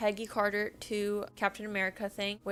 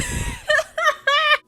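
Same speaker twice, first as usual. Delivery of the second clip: real shrieking laugh; loud high laughter; 11 dB louder than usual. Real, not acted. Reader cracking up at her own note, loud shrieking laughter.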